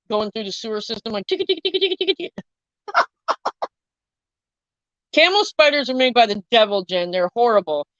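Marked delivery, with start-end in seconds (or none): 1.3-2.4 high, quick ticking patter; rapid-fire pitched-up tick-tick sound of tiny feet; imitated scuttling. Imitating feet going through the sewer system, high scuttling patter.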